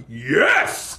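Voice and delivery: acted-out victory cry; imitated burst of joy; mock elation. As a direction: celebrate a win in a put-on voice. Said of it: "when something good happens to me".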